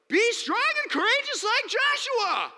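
high, loud, drawn-out parody preacher; mock rallying cry. Parodying how churches preach Joshua, high, loud, slow. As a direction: ham up the preachy slogan.

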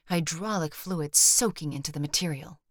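ordinary delivery, nothing marked

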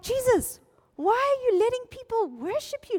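high, indignant mock outrage; furious. Religious rulers confronting Jesus, furious. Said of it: "they are furious".

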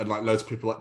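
ordinary talk

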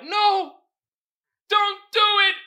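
high, loud hammed-up cry for mercy; mock panicked plea. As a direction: play the victim begging, overdone. Voicing the man with hands up.

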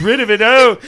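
loud, high alarmed outburst; frantic cry. Retold or imitated. Player acting out his party's panic, frantic.

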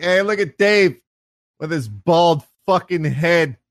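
loud, high jeering call; teasing buddy. Retold or imitated. Comic's buddy busting balls among friends, loud teasing. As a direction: imitate a guy ribbing his pal. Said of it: "sitting around with his buddies busting balls".

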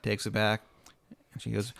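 ordinary delivery

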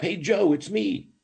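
high-pitched call to a friend; acted holler for help, not full volume; shouting up. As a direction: call up eagerly from the bottom of a hole. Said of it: "the guy shouts up".

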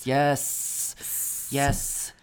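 breathy whispered yes; ASMR whisper. Pretend. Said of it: "this is an A S M R podcast".

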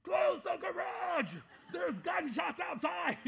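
high, loud, slow shouted command; frantic shout. Retold or imitated. Husband shouts to wife about the gunshots, slow frantic yell. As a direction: bellow the warning, drawn out. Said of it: "I'm like".

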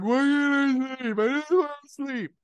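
high, pleading wail of a sleepless man; breaking down. Retold or imitated. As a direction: whine it out like you're at the end of your rope. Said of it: "He's like".

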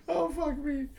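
high-pitched, said through laughter; laughing. Real, not acted. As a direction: still cracking up while talking. Still laughing after the bit.

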